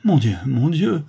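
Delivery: high, anguished exclamation; heartrending cry. A narrator's reading. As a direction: wail it in despair. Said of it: "s'écria la duchesse avec un accent déchirant".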